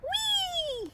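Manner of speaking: very high drawn-out squeal; pixie's gleeful scream. A narrator's reading. Pixie jumping up high, gleeful squeal. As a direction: squeal like a tiny pixie on a slide. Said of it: "he screamed".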